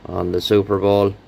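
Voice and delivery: ordinary talk, nothing marked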